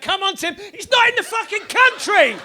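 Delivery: high, loud yell breaking into a laugh; a rowdy fan yelling through laughter; mock fan shout. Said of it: "someone will shout".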